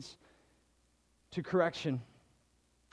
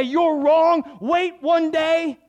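mock protest; 9 semitones higher, 9 dB louder, much slower than usual. What Jesus did not say.

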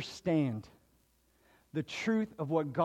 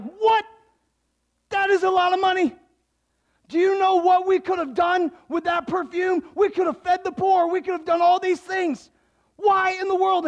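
much higher, loud, fast outrage; Judas freaking out; 11 semitones higher, 7 dB louder than usual. Retold or imitated. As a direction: sputter in outrage about the money. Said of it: "Judas freaks out. He's like".

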